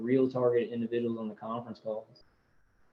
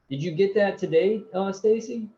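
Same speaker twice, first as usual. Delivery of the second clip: calling out; 8 semitones higher, 4 dB louder than usual. Real, not acted. Calling out to a viewer.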